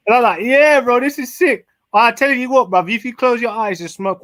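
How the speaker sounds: high, loud hyped-up reaction; acted excitement. Acting out own stoned excitement at the voice.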